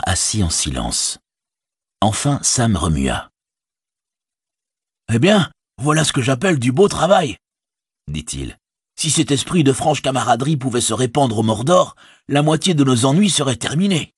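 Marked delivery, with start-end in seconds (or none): 5.1-14.1 higher, lightly ironic hobbit voice; a dry joke after the fight; wry Sam. Acted. Sam joking to Frodo about orc camaraderie, wry.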